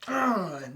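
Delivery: mimicked fighter's straining grunt; imitated effort grunt. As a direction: grunt as if striking a blow. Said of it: "just like".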